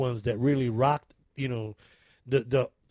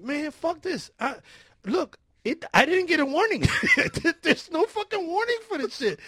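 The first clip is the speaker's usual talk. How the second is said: high-pitched, worked-up complaint with a laugh; indignant protest; 11 semitones higher, 4 dB louder than usual. Retold or imitated. Man packing tells his roommate he's leaving, indignant. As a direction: protest it in hammed-up outrage.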